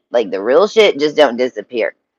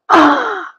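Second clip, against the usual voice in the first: sudden high yelp of shock, much higher; startled cry. Retold or imitated. Baby jumps at her: a high, shocked yelp. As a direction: let out a short, high startled scream.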